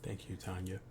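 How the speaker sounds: sultry voice. Pretend. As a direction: purr it like a seduction. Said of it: "you give me a sultry voice?".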